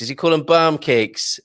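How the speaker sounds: put-on "barm cakes" said in a playful northern English accent; mock northern accent. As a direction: imitate Claire and Steve's northern accent lightly. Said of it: "terrible accent there".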